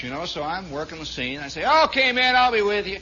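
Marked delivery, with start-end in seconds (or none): 1.8-3.0 put-on Southern dialect; imitated Southern buddy, raised voice; Southern accent. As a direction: answer like one of the Southern boys. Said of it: "I can speak the dialect".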